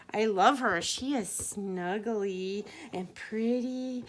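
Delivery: plain reading voice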